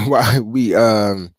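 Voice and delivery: ordinary talk, nothing marked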